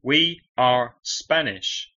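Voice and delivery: ordinary delivery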